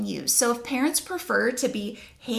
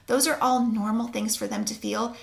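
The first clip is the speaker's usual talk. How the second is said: usual speaking voice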